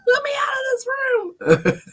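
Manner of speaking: high squealing cry to escape; mock terrified plea. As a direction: ham up the panic. Voicing scared dog.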